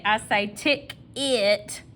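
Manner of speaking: "tick it" slowly, as two words; Southern "tick it" for "ticket". Shown as a demonstration. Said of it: "Southern dialect strikes again".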